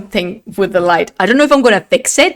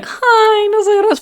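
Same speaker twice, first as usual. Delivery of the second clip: much higher squeaky "Hi, no soy Rose"; silly high voice; 11 semitones higher than usual. Pretend. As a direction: chirp it in a cartoon-high voice.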